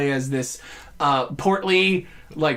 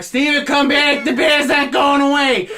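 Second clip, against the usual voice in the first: higher, louder call to a friend running off; 8 semitones higher, 6 dB louder than usual. Retold or imitated. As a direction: shout after him, worried. Calling friend back in game.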